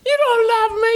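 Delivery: high, whiny pouting reply; pouting driver. Retold or imitated. Driver sulking at crew chief's affection, high whiny pout. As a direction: whine it like a sulking kid. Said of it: "I said, "I love you, Tommy".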